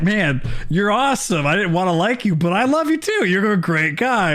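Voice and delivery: half-annoyed gush, played for laughs; imitating grudging Eddie. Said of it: "annoyed 'cause he's like".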